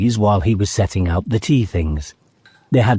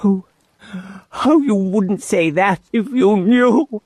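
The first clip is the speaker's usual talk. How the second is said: between sobs; 12 semitones higher than usual. A narrator's reading. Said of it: "between his sobs".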